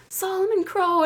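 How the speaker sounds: high, feeble little call; small high-pitched wimpy voice. Retold or imitated. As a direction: call the name timidly, too weak to be heard. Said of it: "like, wimpy".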